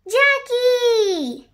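high, drawn call to a friend; excited shout. A narrator's reading. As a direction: call out to a playmate outside. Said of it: "he shouted".

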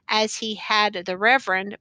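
usual speaking voice

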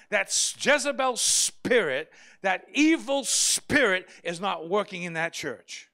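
loud, indignant pulpit voice; fiery preaching. Acted. Pastor denouncing a church event, loud and indignant. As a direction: rail against it with outrage.

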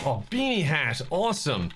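ordinary talk

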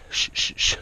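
soft unvoiced shushing; whispered shh to settle the tiger; whispered hush. Real, not acted. Hushing the tiger.

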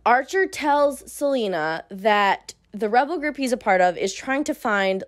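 plain reading voice

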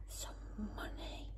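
breathless hushed wonder; gasped whisper. A narrator's reading. Narrator to children, awed whisper. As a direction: gasp then whisper in awe. Gasp at the coin.

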